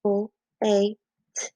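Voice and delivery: "O A T" as separate phonemes with gaps; tapped-out sounds. Shown as a demonstration. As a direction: sound out the end of flate sound by sound. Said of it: "tap out".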